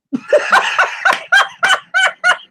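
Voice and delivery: real laugh after mocking the vegan; high cackling laugh. Real, not acted. Laughing hard at his own joke.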